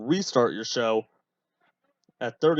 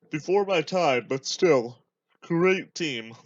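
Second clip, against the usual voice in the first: talking through a yawn; words stretched by a yawn; 4 semitones higher, slower than usual. Real, not acted. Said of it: "Sorry, I'm yawning a bit".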